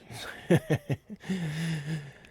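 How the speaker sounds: acted scornful chuckle; in-character chuckle. Kenku hears Poseidon's name, acted chuckle. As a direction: chuckle at a foolish notion. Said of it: "he chuckles".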